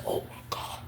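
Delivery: breathless whisper; whispered shock. Retold or imitated. Narrator reacts to the insult under his breath. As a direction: whisper it in disbelief.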